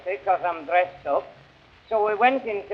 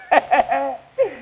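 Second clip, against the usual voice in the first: hearty laugh; 5 semitones higher than usual. Acted. Comedian laughing after threatening the best man. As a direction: laugh at your own punchline.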